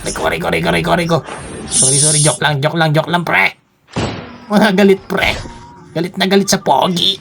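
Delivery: high, squeaky playful alarm; hammed-up high-pitched begging; mock panic. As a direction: plead in pretend fright, words tumbling in repeats. Pretend panic, begging enemies off.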